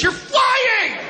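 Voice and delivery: very high shouted disbelief; exasperated yelling; incredulous shout. Acted. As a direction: yell it at the spoiled flyer. Ranting at complainers.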